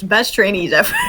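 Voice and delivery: can't hold back the giggles; cracking up at the trainees; laughing. Real, not acted. Players mess up formation.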